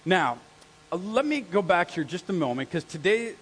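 ordinary talk

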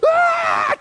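very high, loud mock shriek; imitated scared scream. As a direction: shriek like a terrified small child. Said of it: "J C turns and just".

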